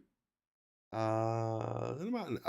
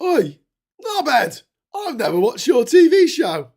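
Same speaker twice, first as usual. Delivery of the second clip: loud, higher mock shout across the street; rude stranger shout; 11 semitones higher, 12 dB louder than usual. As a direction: yell it at him like a cheeky passer-by. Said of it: "they crossed over and they went".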